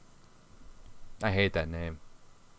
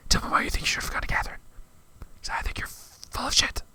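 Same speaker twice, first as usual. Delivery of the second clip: furious whisper; 12 semitones higher than usual. Real, not acted. Whispered rant at character.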